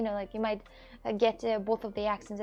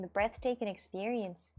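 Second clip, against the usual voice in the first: ordinary talk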